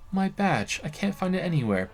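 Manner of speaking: a hint of a nervous detective; cop character voice. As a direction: play the awkward cop reporting in.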